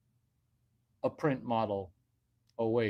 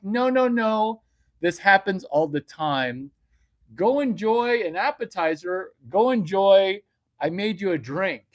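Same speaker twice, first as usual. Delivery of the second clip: high, loud mock girlfriend shooing him; girlfriend insisting; 8 semitones higher, 9 dB louder than usual. Girlfriend sending him off to drinks, very high and loud. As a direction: push him away, shrill and loud. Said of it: "She's like".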